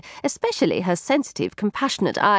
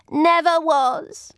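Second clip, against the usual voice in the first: tag; 8 semitones higher, much slower than usual. Acted. Satin interrupts in praise.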